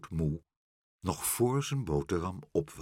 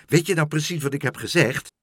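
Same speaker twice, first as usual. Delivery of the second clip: high, raised, insistent question; calling out; 6 semitones higher, 6 dB louder than usual. A narrator's reading. Meneer Pen checks what Karel heard, calling out. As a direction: check loudly that they listened. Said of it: "riep meneer Pen".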